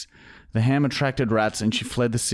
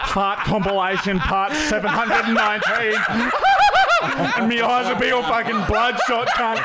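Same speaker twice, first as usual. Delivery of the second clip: laughing while talking; 12 semitones higher than usual. Real, not acted. Cracking himself up with a fart joke.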